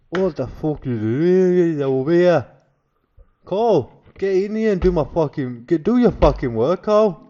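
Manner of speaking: loud Glaswegian tutor, badly imitated; Gordon Young. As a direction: bellow orders in a rough attempt at Glaswegian. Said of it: "this is how it sounded in my head".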